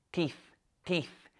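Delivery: TEETH said with air flung up into the nose; nasal teeth. Shown as a demonstration. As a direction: send the sound of "teeth" up through the nasal cavity. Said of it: "you're doing it wrong".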